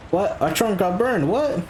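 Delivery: high-pitched incredulous outburst; real disbelief. Real, not acted. Player learns the trunk got burned, incredulous. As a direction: pitch leaps way up in shocked protest. Reacts to losing trunk in game.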